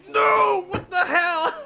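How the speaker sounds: high, loud mock screaming through laughter; imitated scream. Imitating a friend flying towards the rock, screaming. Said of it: "you were just screaming like".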